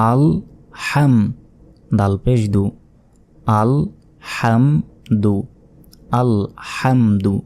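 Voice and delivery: slow recitation teacher, closing the ল of আল and holding the ম of হাম; syllable-by-syllable demonstration. Shown as a demonstration. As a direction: sound out আল, হাম, দু as separate held syllables. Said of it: "এভাবে উচ্চারণ করতে হয়".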